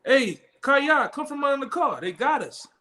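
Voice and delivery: high, urgent call to a buddy under a car; a friend yelling that the cops are here; urgent warning call. Retold or imitated.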